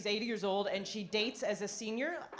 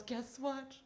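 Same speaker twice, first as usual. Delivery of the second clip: confiding husband; 3 semitones higher, 8 dB quieter than usual. Retold or imitated. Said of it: "he cups the phone".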